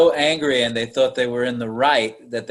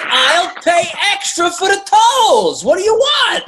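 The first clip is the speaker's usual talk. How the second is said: high, louder, slow eager caller voice; mock eager caller; 6 dB louder, slower than usual. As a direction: ham up a desperate caller, pitched way up.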